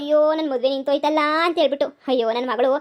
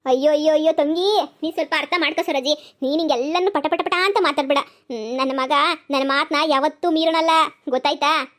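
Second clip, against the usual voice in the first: ordinary talk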